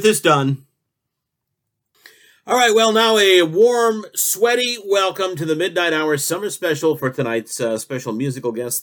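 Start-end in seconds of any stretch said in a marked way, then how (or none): 2.4-8.8 talk show host. Acted.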